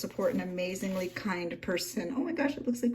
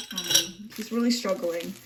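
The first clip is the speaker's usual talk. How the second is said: ordinary talk, nothing marked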